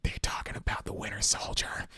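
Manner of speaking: hushed, breathy aside to himself; whispered inner thought. Retold or imitated. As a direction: whisper it like a secret thought in the theater. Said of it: "In my head, I went".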